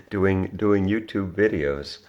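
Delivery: amused laugh at his own daydream. Real, not acted. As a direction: laugh it out at the idea. Laughing about making millions.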